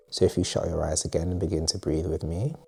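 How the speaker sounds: soft, calm guiding voice with spacious pauses; guided meditation. Acted. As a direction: lead them quietly into breathing and rest. Leads a breathing exercise.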